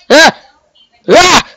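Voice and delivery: high, sudden "ah" yelp; two sharp, high-pitched cries; short yelps. Pretend. Gamer reacting to his invisible head, yelping.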